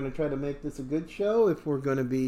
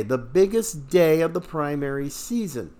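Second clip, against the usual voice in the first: ordinary delivery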